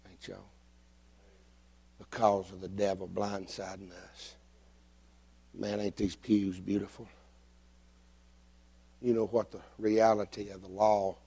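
low, soft confiding sermon voice; hushed, solemn preaching. Real, not acted. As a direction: bring it right down, grave and intimate. Preacher turns solemn and quiet.

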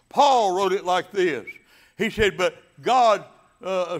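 loud, high, rolling preacher cadence; full-force preaching. Acted. Pastor preaching to his congregation, loud and fervent. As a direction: drive the scripture home to the congregation.

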